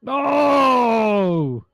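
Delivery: high, sliding long NO; drawn-out cry of no. Acted. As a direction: wail it out in mock dismay. Player's drawn-out reaction to the game.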